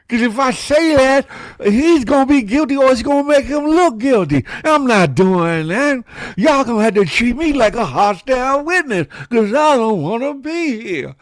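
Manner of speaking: high, loud defiant witness voice; reluctant witness Mob James. Retold or imitated. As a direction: refuse to talk, loud and high, like a stubborn witness. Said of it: "He's like".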